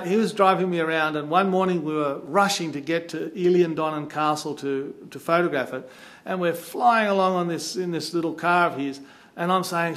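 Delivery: plain reading voice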